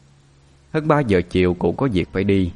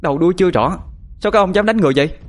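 furious scolding; 10 semitones higher, much faster than usual. A narrator's reading. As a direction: snap at the crowd in outrage. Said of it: "Bà tôi lao lên quát".

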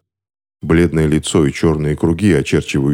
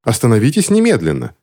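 higher-pitched indignant lady; 6 semitones higher than usual. A narrator's reading. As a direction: order a stop in mild outrage. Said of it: "возмутилась женщина в шляпке клош".

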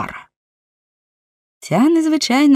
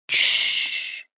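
long unvoiced "shh"; shushing; 4 dB quieter than usual. A narrator's reading. Servant breaks off his grumbling at a noise, shushing. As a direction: hush sharply to listen.

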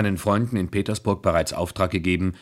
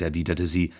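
usual speaking voice

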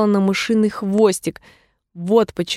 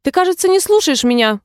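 sudden stern reproach, pitched up; higher, strict mouse voice; 7 semitones higher than usual. A narrator's reading. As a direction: scold a pupil who isn't listening. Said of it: "вдруг строго сказала мышь".

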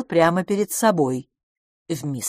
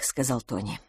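ordinary delivery, nothing marked

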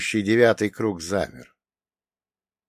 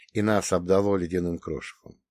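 ordinary delivery, nothing marked

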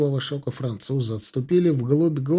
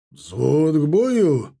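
drawn-out military command; barked order; 4 dB louder, much slower than usual. A narrator's reading. Officer calling the platoon to battle, barked order. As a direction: bark it slowly to the whole platoon. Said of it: "рявкнул Кожухов".

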